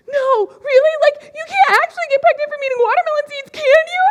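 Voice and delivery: put-on high, fast, worried child's voice; anxious little kid. Six-year-old [name] begging the big kids for reassurance, high and anxious. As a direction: play her six-year-old self fretting. Child self pleading with sister's friends.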